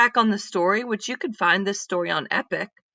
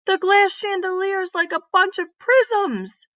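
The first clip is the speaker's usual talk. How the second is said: put-on higher, proud kid's explanation; Mike's discovery; 12 semitones higher than usual. Mike tells Miss Reed his conclusion, higher and proud.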